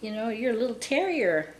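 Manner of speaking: high cooing, imitating someone fussing over a puppy; retold high-voiced puppy adoration; retold doting pet talk. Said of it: "he started, you know, saying".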